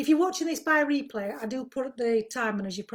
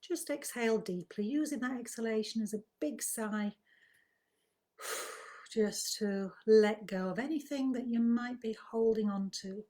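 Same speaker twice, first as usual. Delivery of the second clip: guided meditation; 4 dB quieter than usual. Acted. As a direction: ease listeners gently into calm, softly and unhurriedly. Leads a breathing relaxation.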